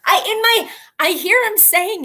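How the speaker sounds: giggling "I can't" through laughter; laughing. Real, not acted. Laughing as she fails to say the word again. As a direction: laugh it out, giving up. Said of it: "laughs at own failure".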